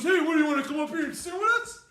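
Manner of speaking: loud, high shouted invitation; loud high shout across a stage. Retold or imitated. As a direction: call him up onto the stage.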